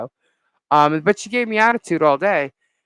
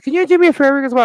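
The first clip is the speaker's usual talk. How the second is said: frantic plea; 10 semitones higher, 7 dB louder, much faster than usual. Retold or imitated. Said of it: "I said".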